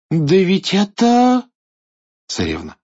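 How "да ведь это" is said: high, loud amazed gasp; astonished. Acted. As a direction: recognize her in shock. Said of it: "изумлённо". (изумлённо)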